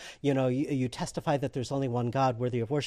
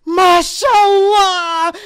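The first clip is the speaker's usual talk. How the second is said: high, loud, long-drawn exclamation; joyous shout; 13 dB louder, much slower than usual. Retold or imitated. Worshipper greeting the would-be convert, joyful shout. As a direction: belt it out in delighted welcome. Man rejoices, hugs the newcomer.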